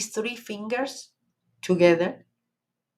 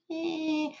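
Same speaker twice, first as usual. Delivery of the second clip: whiny victim voice; 4 semitones higher, slower than usual. Pretend. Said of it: "Or you're gonna".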